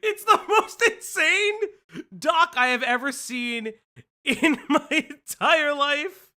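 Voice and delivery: high, loud, incredulous exclamation; loud, astonished outburst with laughs; laughing disbelief. Real, not acted. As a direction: exclaim it through laughter, stretching the words. Incredulous rant about a document.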